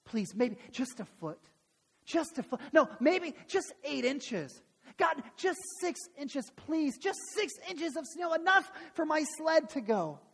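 acted kid begging, rising in pitch; pleading prayer. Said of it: "he's praying to God".

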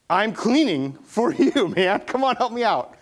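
high, slow, pleading with a laugh; exasperated plea. Retold or imitated. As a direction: beg the roommate for help, half laughing. Retold plea to roommate.

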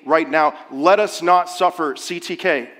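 ordinary talk, nothing marked

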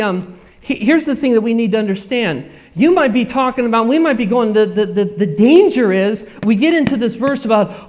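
ordinary delivery

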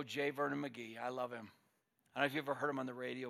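ordinary talk, nothing marked

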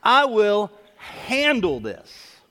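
mock Southern threat, each word drawn out; threatening Southern mom. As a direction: imitate a Southern mom laying down a promise. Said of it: "I heard a threat and a promise".

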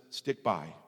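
low, soft, steady answer; calm divine voice, low and unhurried; God's calm reply. Retold or imitated. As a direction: answer quietly and firmly, pitch dropped. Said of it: "And God says".

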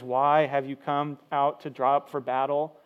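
usual speaking voice

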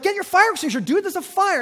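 urgent shout at a confused police officer. Retold or imitated. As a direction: make him understand there's a fire. Yelling at confused cop.

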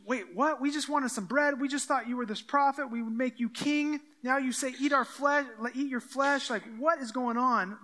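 confused, agitated objection; baffled crowd. Acted. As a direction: protest in growing bewilderment. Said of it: "people are like".

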